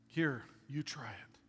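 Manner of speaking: soft, whispery invitation; quiet mock-solemn mentor; hushed. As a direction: offer it gently, under your breath. Mentor quietly hands over the wrench.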